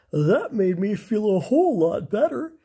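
an ape cheered up after a hug; relieved orangutan. Acted. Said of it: "he said".

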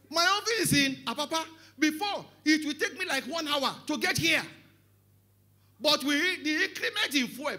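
high, loud sermon delivery; full-force preaching. Real, not acted. Preacher telling of those in David's time, full-force preaching. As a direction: proclaim it loudly from the pulpit.

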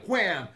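sharp high "wham" for the hit; mock impact "wham". Retelling the tree hit, a sudden high "wham". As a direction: blurt the crash of a head on a branch.

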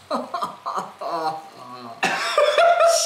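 loud helpless laugh; burst of laughter. Real, not acted. Friend seeing the photo, bursting into loud laughter. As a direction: crack up at the picture.

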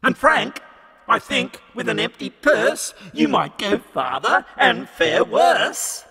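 high, sneering devil's refusal; a devil who scorns the poor; Satan crying out. A narrator's reading. Satan answering Frank.

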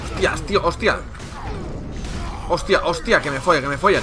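swearing loudly in a panic; loud panicked cursing. Real, not acted. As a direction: yell the curses, caught off guard.

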